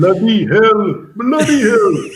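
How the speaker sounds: hammed-up Welsh accent; Welsh accent impression. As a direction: put on a broad Welsh accent for a joke. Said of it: "my impression of a Welshman".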